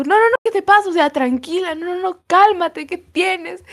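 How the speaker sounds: high, fussing voice of a flight attendant calming a passenger; soothing flight attendant. Retold or imitated. Flight attendant calming a crying passenger, high and fussing. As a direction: coo at her to calm down, pitched high. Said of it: "la hermosa me dijo".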